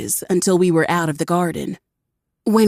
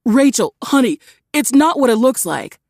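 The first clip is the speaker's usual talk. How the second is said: higher, louder pleading husband; pleading man; 7 semitones higher, 5 dB louder than usual. A narrator's reading. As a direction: beg her to believe you.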